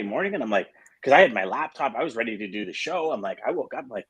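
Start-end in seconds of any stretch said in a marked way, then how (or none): none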